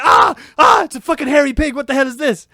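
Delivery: high, loud scream of alarm; panicked yelling. Retold or imitated. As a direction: shriek in shock, then blurt it out loud. Retold fright at hairy pig.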